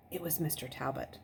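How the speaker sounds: ordinary delivery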